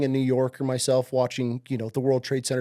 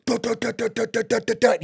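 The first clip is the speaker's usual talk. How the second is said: higher staccato gun bursts; machine gun noise; 8 semitones higher than usual. Retold or imitated. Imitating the Ma Deuce heard on the news, staccato bursts. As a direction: rattle off fifty cal fire with your mouth.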